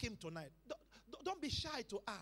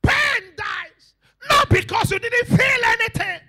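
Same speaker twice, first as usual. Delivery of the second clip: high, very loud proclamation; full-force preaching; 12 semitones higher, 22 dB louder, slower than usual. Real, not acted. Preacher declaring that pain ends, shouting. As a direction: shout it over the congregation with total conviction.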